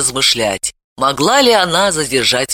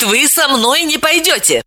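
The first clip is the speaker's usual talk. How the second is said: irritated threat; 10 semitones higher than usual. A narrator's reading. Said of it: "угроза в её раздражённом голосе".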